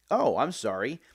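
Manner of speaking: ordinary delivery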